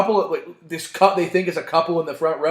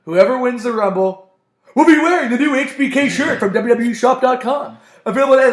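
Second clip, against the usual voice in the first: higher, louder hammed-up pitchman; mock Shawn Michaels shill; 6 semitones higher, 4 dB louder than usual. Friend mimics Shawn Michaels plugging his shirt, hammy pitchman voice. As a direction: sell the shirt like a cheesy ad. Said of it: "Shawn Michaels like".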